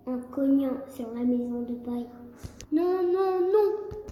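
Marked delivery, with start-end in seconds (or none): 2.7-4.0 high, sung-out little pig's no; a piglet chanting his refusal. A narrator's reading. Little pig answering the wolf, high and sing-song. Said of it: "répondit Gaston".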